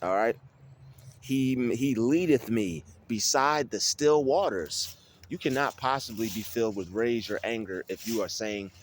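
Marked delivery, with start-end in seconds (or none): 1.2-5.0 slow, deliberate verse reading; slow, measured scripture reading; slow recitation. Shown as a demonstration. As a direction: draw the verse out slowly.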